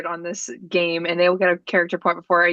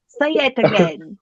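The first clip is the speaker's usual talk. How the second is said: put-on British accent. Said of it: "In a British accent".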